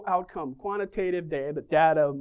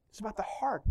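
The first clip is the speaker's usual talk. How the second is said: whispered point; 10 dB quieter, faster than usual. Real, not acted. Preacher whispering the heart of the sermon to the congregation. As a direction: whisper the answer, unvoiced and intimate.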